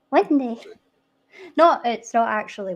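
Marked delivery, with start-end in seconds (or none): 0.1-0.6 "Windy" for window, ending in ee; Edinburgh dialect pronunciation. Shown as a demonstration. Repeating the childhood word, "Windy" with ee for -ow. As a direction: finish "Windy" on an ee sound. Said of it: "I would say the windy".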